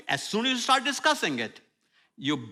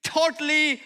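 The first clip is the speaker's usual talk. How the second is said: rising preaching; 6 semitones higher, slower than usual. Real, not acted. Preaching climax about God.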